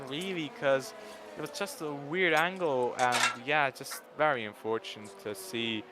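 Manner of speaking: normal talking voice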